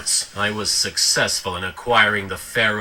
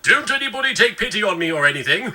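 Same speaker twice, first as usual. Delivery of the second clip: high, quick sulky whine; pouty rushed complaint; mock self-pity; 6 semitones higher, 3 dB louder than usual. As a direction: whine fast, fishing for pity. Sulking, fishing for pity.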